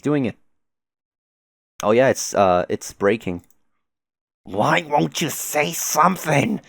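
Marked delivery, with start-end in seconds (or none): 4.4-6.6 higher, slow, drawn-out desperate plea; anguished demand. Acted. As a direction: demand an answer, stretching the words upward. Acted cry during a fight.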